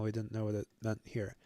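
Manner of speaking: normal talking voice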